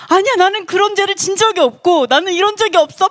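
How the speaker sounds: high, insistent mock denial; indignant self-defence. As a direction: argue back as if falsely accused. Acting Job arguing in self-defence. (높은 톤으로 억울하게 변론하는 흉내)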